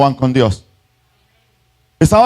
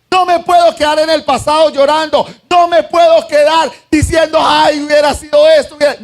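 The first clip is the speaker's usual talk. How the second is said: fervent preaching; 8 semitones higher than usual. Acted. Preaching at full force.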